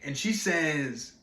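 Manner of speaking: ordinary talk, nothing marked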